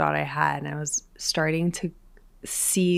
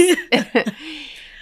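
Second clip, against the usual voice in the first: laugh; 10 semitones higher, 4 dB louder than usual. Real, not acted. Disbelieving laugh at own story.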